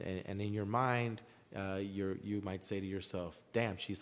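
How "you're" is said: ordinary delivery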